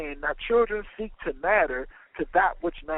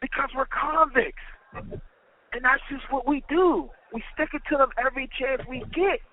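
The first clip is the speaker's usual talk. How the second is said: dead serious, higher-pitched declaration; all seriousness; 6 semitones higher than usual. A narrator's reading. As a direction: state the convict code in earnest. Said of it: "with all seriousness".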